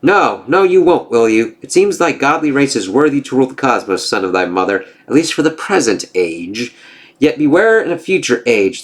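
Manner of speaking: lightly voiced gods trading taunts; storybook reading of a Norse comic; comic dialogue read-aloud. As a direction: read the panels with a hint of character.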